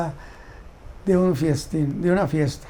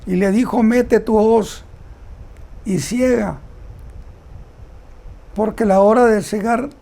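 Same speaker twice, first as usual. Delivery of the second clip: raised, proclaiming Bible reading; emphatic scripture reading; 3 semitones higher than usual. Acted. Preacher reading the angel's call to reap, emphatic scripture reading. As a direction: proclaim the verse firmly, with long pauses.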